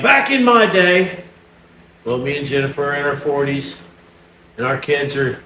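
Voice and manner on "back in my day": higher, slow, drawn-out mock reminiscing; lightly hammed-up old-timer opening; mock old-timer catchphrase. Parent teasing about talk to the kids, mock old-timer. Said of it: "you're always like".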